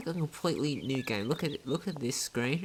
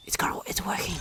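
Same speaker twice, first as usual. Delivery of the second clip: thrilled hushed whisper, pitched up; excited whisper; 7 semitones higher, 4 dB louder, faster than usual. Real, not acted. Player sneaking, key finally turns, excited whisper. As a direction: whisper the win so nobody hears.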